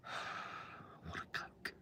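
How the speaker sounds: "I want a Coke" whispered slowly, almost defeated. Shown as a demonstration. Customer wearily whispering an order for Coke. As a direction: breathe it out, weary of repeating. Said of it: "can't go to a restaurant and flipping ask for a cup of Coke".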